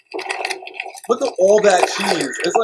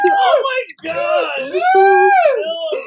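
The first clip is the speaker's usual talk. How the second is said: high panicked cries; panicked high wails; horrified shrieking; slower than usual. Real, not acted. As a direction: shriek in horror at the clip. Horrified shrieking, crying laughter.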